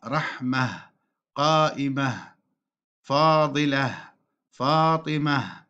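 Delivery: "رحمة، قائمة، فاضلة، فاطمة" each ending in h; pausal ha. Shown as a demonstration. Teacher listing examples, "رحمة، قائمة، فاضلة، فاطمة" stopped on h. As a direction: separate words, each feminine ending breathed as h, never t. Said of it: "تقف بالهاء".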